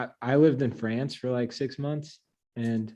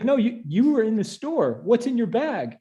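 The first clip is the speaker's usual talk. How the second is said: accusing guard; 10 semitones higher than usual. Retold or imitated. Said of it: "And he's like".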